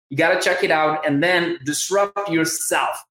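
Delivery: normal talking voice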